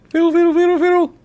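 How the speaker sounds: high squeaky fiddle fiddle; silly high voice. Pretend. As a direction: squeak it out playfully. Silly repeat of instrument.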